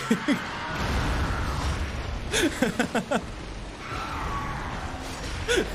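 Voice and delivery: breathless airy cackle; long unvoiced wheeze of laughter; long wheezing laugh. Real, not acted. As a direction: laugh until out of air.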